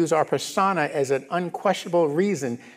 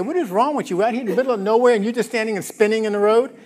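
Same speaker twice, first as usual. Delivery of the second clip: judging scoff; 7 semitones higher, faster than usual. Retold or imitated. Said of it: "stand off and judge him and say".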